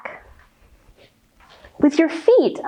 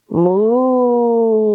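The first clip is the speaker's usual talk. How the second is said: loud, drawn-out moo; cow moo; 2 semitones lower, 3 dB louder than usual. Acted. Kitten asks cow about purr, cow answers with a moo. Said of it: "said Cow quickly".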